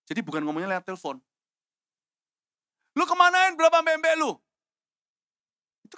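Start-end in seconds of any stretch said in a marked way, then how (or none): 2.9-4.4 high, full-force angry yell; battlefield shout. Shown as a demonstration. Brother scolding Daud publicly on the battlefield, loud shout. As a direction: bellow it across a battlefield. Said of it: "Itu ngomongnya keras loh. Ini di medan perang".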